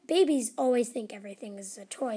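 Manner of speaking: ordinary delivery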